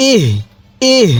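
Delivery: ইহ with an audible breathed h; short ইহ, ending on a puff of breath; light throat sound. Shown as a demonstration. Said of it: "হালকা গলার মধ্যে একটু আওয়াজ করব".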